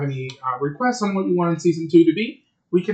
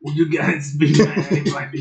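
put-on voice. Host asks cohosts about doing voice. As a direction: keep the joke voice going. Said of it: "I like this voice".

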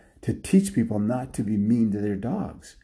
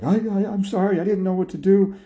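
high, quick, stammering apology; flustered apology; 10 semitones higher, 4 dB louder than usual. Acted. Lady to the dog yelling at her, high flustered apology. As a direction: plead in a high, hurried voice.

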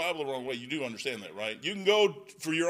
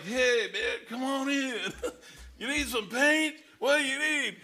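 higher, loose and laughing; higher, giggly paint-locker crew; mock laid-back crew; 8 semitones higher than usual. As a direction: voice the relaxed crew inviting you in. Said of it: "They're in there like".

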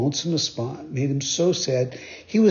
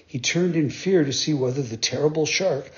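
ordinary delivery

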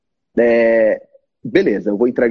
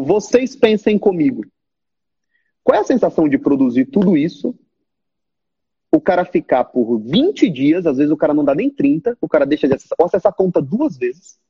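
ordinary talk, nothing marked

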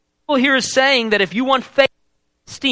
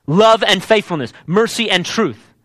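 usual speaking voice

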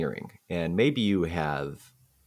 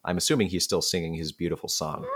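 normal talking voice

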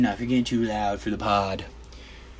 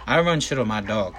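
usual speaking voice

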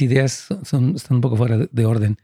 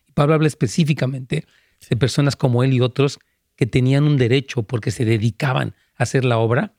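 ordinary delivery

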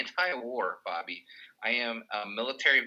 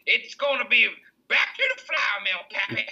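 much higher, loud hammed-up Southern stump politician; Homer Stokes; 11 semitones higher, 5 dB louder than usual. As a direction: crow it like a gloating old-time candidate. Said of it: "and Homer Stokes says".

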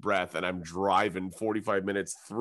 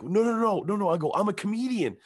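high, louder, hurried-sounding denial; panicked protest; 11 semitones higher, 3 dB louder than usual. Retold or imitated. Comedian denying any link to the attacker, panicked. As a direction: plead innocence in an acted panic. Pleading innocence to armed accusers.

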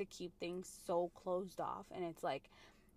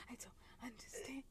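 whispering; 12 dB quieter than usual. Real, not acted. Whispered aside.

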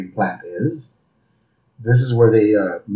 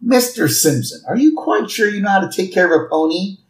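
higher, quick, doubtful lady voice; prim saleslady; 6 semitones higher than usual. Acted. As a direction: question the buyer in a raised pitch. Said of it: "And the lady goes".

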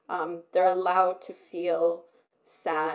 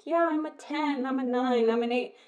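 mimicked put-on cheer; mock upbeat check-in answers; false positivity; 4 semitones higher than usual. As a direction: imitate colleagues pretending all is well, pitched up at the start. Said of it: "put on this false pretense".

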